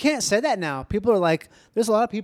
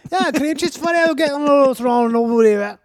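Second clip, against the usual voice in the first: loud, high, garbled mock Scottish; wildly exaggerated Scottish accent; insane Scottish accent; 9 semitones higher, 7 dB louder than usual. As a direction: slur out incomprehensible Scottish gibberish. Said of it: "Imagine ordering bagels in Scotland".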